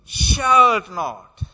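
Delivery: "Shalt not" hit hard and loud, falling; strong emphasis. Shown as a demonstration. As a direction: punch "Shalt". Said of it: "It's a strong emphasis".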